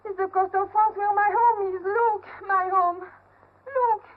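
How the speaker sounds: high and loud, voice breaking into tears; tearful longing. Acted. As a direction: cry out with homesick tears. Sees her home, weeps.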